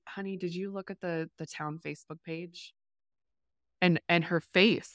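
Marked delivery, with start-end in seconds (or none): 0.1-2.7 much softer, hushed worried mother; hushed mom. Retold or imitated. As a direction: murmur it like a mom waking you late. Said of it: "she goes".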